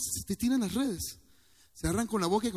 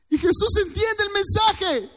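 much higher, slow and stretched; emotional preaching; 13 semitones higher, 7 dB louder than usual. Real, not acted. Preacher moved by his own sermon, high and slow. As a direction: let the feeling lift the line high.

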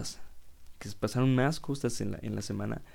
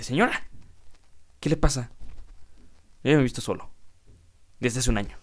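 louder, indignant mock comeback; indignant retort; 2 semitones higher, 5 dB louder, faster than usual. Young man snapping back at the optician lady, indignant and loud. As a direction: fire back at the nosy lady, offended. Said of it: "Yo así de".

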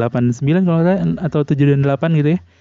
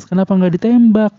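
raised, higher heckling question; heckling moviegoer; 7 semitones higher, 4 dB louder than usual. Retold or imitated. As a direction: call out at the screen. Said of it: "Ada ibu di belakang nyaut gitu".

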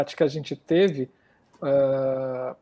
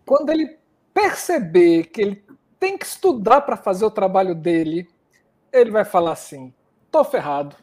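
put-on Bahian accent, raised pitch and louder; Bahia accent; 3 semitones higher, 4 dB louder than usual. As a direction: tell it like a Bahian joke. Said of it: "eu já tô com sotaque baiano".

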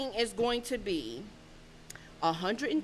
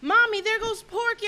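imitating little daughter; 10 semitones higher than usual. Imitating six-year-old daughter.

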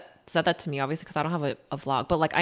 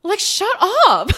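much higher, louder fed-up shut up; exasperated; 4 dB louder than usual. Real, not acted. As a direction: snap it out half laughing. Exasperated reaction with laugh.

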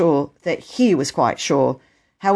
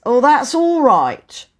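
lightly voiced official, raised and drawn-out; genial station master; 9 semitones higher, 5 dB louder, slower than usual. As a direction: wave away their thanks. Station master accepts thanks.

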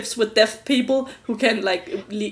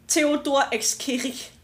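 slow, strong German accent; German-Austrian accent; much slower than usual. Shown as a demonstration. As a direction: read the name like an Austrian, drawn out. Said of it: "with a strong German, Austrian accent".

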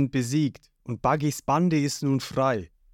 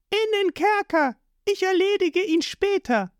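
put-on much higher, commanding girl's voice; Nami's voice. A narrator's reading. Nami ordering a fish-man, high and cold.

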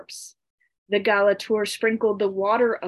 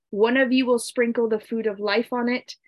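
ordinary delivery, nothing marked

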